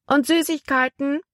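put-on high small-boy voice; an eager little boy asking about sweets. A narrator's reading. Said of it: "wollte ein kleiner Junge wissen". (hohe Stimme eines kleinen Jungen)

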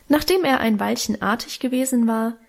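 ordinary delivery

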